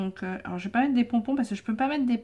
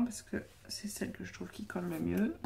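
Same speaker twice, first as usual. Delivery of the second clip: whispering; 8 dB quieter than usual. Real, not acted. Aside while choosing the glue.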